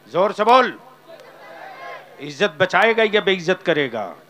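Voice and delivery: loud rousing call to the crowd; loud challenge. Real, not acted. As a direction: goad the crowd into answering. Preacher goading crowd loudly.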